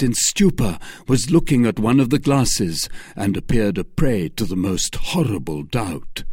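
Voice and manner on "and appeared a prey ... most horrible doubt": ordinary delivery, nothing marked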